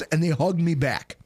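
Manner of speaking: ordinary talk, nothing marked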